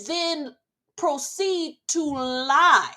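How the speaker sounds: high, slow, drawn-out indignation; outraged disbelief. Real, not acted. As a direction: stretch each word out in disbelief. Outraged about Mary lying.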